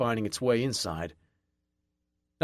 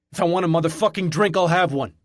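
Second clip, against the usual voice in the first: fast, higher and louder in fury; raging; 6 semitones higher, 6 dB louder than usual. A narrator's reading. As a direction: spit it out in a fast rage. Raging at bartender.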